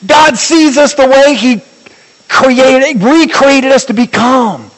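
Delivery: high, sustained preacher's proclamation; fervent, ringing sermon climax; impassioned preaching. Real, not acted. Preaches at full force.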